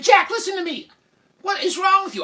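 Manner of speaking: very high, loud exasperated mom; scolding mother. Acted. Mother scolding Jack over wet pants, exasperated. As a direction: screech at your son in mock dismay.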